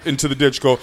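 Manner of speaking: ordinary delivery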